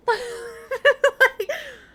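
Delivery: loud high laughing fit; loud laughter. Real, not acted. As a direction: crack up loudly, high and hard. Bursts out laughing at the story.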